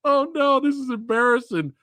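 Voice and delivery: high, dismayed mock wail; retold scream. Host re-enacting their reaction to the botched match, mock screaming. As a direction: wail it as a horrified viewer. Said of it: "We were screaming. We were like".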